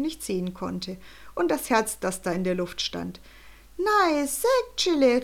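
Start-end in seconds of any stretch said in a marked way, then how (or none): 3.8-5.2 high, slow Swiss German cry of dismay; Swiss German exclamation. A narrator's reading. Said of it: "rief eine Stimme".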